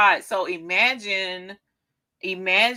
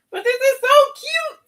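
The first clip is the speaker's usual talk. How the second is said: excited; 6 dB louder than usual. Real, not acted. Admiring herself.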